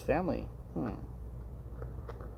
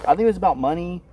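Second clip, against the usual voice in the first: louder, firmer repeat; 5 semitones higher, 5 dB louder than usual. Real, not acted.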